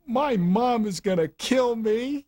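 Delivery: imitating a crying player begging not to be cut; retold sobbing plea. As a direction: plead through tears, voice way up. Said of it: "I shifted into another gear of crying".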